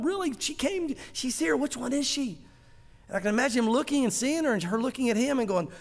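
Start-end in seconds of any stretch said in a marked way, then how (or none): 0.0-2.4 a much higher, delighted disbelief; can't believe she's really here; amazed Isaac. Retold or imitated. Isaac, told his bride has come, asks in high delighted disbelief.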